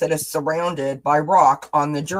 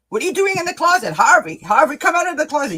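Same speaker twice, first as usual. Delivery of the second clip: high, fast, loud mock wife; wife yelling; 10 semitones higher, 5 dB louder, faster than usual. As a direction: shout up at the husband in the closet. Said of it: "yelling his name".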